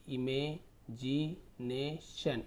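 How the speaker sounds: Imagination drawn out very slowly, syllables split. Shown as a demonstration. Teacher tells class to read imagination syllable by syllable. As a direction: read imagination broken into pieces. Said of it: "तोड़ तोड़ के पढ़िए आप".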